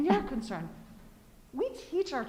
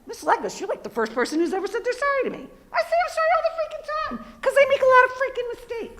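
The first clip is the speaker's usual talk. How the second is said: put-on higher, louder, fast kid voice; 11 semitones higher, 8 dB louder, faster than usual. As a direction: voice the child quickly and loudly. Parent voices a kid's words.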